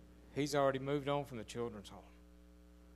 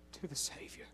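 whispered; 8 dB quieter than usual. Acted. Solemn aside.

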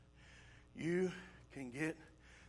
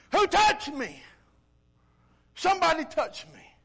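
raised, higher-pitched demand; calling out; 6 semitones higher, 5 dB louder than usual. Acted. Jesus asking the crowd who touched him, loud call. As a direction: call out sharply over a crowd.

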